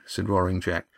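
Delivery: usual speaking voice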